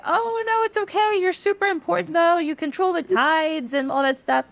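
high, put-on consoling voice, easing down; reassuring friend. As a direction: play the upbeat pal cheering someone up, pitched high. Sun reassures the moon.

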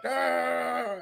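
high, soft, drawn-out "ah"; wordless stand-in for someone talking away; imitated chatter. Imitating new guys talking to him in warm-ups, high soft drone. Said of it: "talking to me, like".

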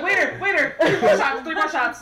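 re-enacted shout for service; calling out. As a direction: holler an urgent bar order through laughter. Calls out to waiter in retelling.

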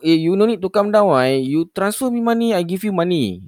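high pushy loan agent; eager telemarketer pitch; scam caller voice. Retold or imitated.

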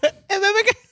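very high, loud squeaky falsetto; big guy with a comically squeaky voice; pipsqueak voice. Acted. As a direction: squeak it out in a tiny, piercing man's voice. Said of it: "Squeaky voice".

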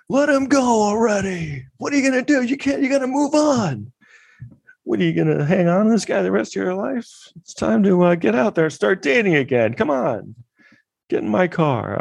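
hammed-up impatient nagging, extremely high; mock tactless counselor badgering a mourner in a squeaky-high voice; Viper. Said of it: "imagine him showing up to".

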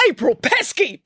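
teacher bellowing at April. Acted. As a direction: roar her full name. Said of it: "bellowed Mister Lang".

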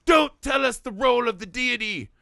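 a preacher snapping back in outrage; mad preacher. Retold or imitated. Preacher rejecting God's messenger, angrily. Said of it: "he gets so mad".

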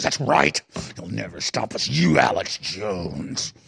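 low, very slow demonic taunt on the name. Retold or imitated. Host mimicking globalist guest taunting him by name, slow demon. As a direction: draw out the name like a gloating demon. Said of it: "He actually talked like a demon".

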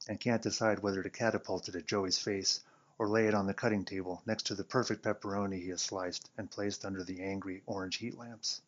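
plain reading voice

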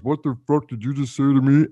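imitating an offended big man; mock angry challenge. Giant confronts the buddy who insulted him, loud mock challenge.

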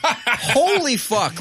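loud, high, slow outburst; stretched-out high yell of disbelief; real shout. Real, not acted. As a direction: blurt it out in shock. Reacting to sandwich photo.

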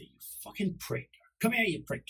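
high, playful mock scolding; joking cursing. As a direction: scold the dog as a joke. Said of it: "it was never in anger".

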